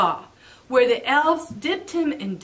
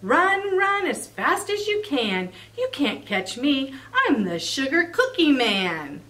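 cookie taunt; 2 semitones higher than usual. Acted. Cookie character chant.

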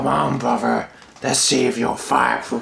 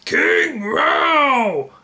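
shouting; 9 semitones higher than usual. Acted. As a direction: cry out the king's name. Crowd hails the king.

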